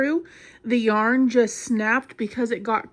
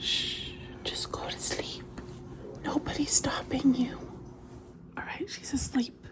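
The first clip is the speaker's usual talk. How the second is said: whispering over a crib; 2 semitones higher, 12 dB quieter than usual. Real, not acted. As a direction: whisper so she stays asleep. Baby falling asleep.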